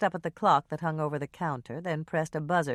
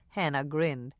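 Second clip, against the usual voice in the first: ordinary delivery, nothing marked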